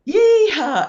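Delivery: high, very loud crowd whoop; full-voiced high holler; screamed out loud. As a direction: let rip a long yeehaw like a cheering crowd. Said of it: "they just screamed out loud".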